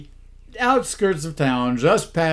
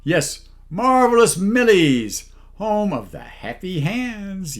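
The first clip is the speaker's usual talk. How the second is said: slow, higher and louder mock ad read; sponsor pitch; 6 semitones higher, 6 dB louder, slower than usual. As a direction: sell it like a cheesy commercial. Sponsor read for massage mart.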